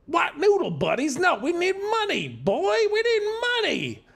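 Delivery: very high, squeaky demanding voice; whiny thug. Acted. Jerry tells his partner they need money, squeaky whine. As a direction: insist on money like a petulant goon.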